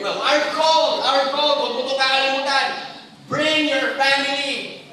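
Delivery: rally the church to bring their families; loud preaching. Real, not acted. Preaching climax.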